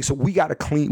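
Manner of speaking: usual speaking voice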